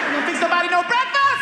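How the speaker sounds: high, loud complaint; hammed-up outraged demand; brazen demand. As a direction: yell it like a cheeky, hungry son. Son pushing his luck loudly.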